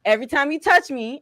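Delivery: normal talking voice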